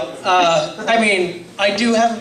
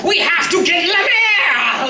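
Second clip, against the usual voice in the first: higher, slow cartoon villain rallying cry; rally the gang like a cartoon baddie; mock Cobra villain shout; villain henchman shout; 11 semitones higher, 3 dB louder than usual. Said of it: "they're just like".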